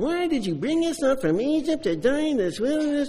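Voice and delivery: high, whining complaint; whiny voice. Acted. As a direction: whine it like a nagging crowd. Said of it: "I have to put my whiny voice on".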